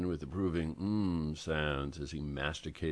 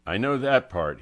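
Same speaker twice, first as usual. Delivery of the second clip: louder, chatty retort; garrulous; 4 semitones higher, 7 dB louder than usual. Acted. Angelo answers Francie's teasing, talkative and loud. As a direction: brush her joke aside with gusto. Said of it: "he said garrulously".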